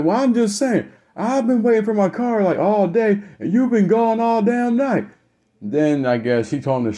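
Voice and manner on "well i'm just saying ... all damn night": imitating an exasperated son, very high-pitched; high-pitched, indignant complaint; aggrieved protest. Son who waited all day for his card, protesting.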